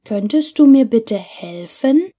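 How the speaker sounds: usual speaking voice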